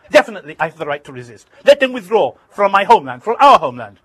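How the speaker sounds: fast and loud, building defiance. Retold or imitated. Arafat shouting at the reporter, too loud for the recorder. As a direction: shout the right to resist. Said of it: "Arafat's voice now grew so loud".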